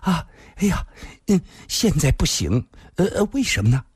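high, flustered little gasps between words; acted breathless coy refusal; quickened breathing. A narrator's reading. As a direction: protest in a high, breath-caught voice. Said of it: "林仙儿的呼吸开始急促".

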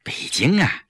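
reluctant, halting answer; evasive, with long hesitations; hemming and hawing. A narrator's reading. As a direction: falter with a sigh between phrases. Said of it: "季嫣然支支吾吾地说".

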